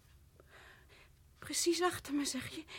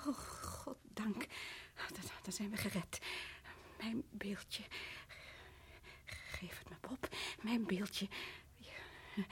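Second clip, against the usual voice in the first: slow, much softer relieved whisper; soft, slow whisper of relief; hushed whisper; 6 semitones lower, 17 dB quieter, much slower than usual. Acted. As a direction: whisper it like a secret. Wife clutches the statue, whispering.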